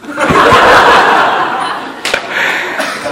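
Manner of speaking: real laughter at his own story; hearty laugh. Real, not acted. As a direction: burst out laughing, then let it fade. (讲道时真心大笑)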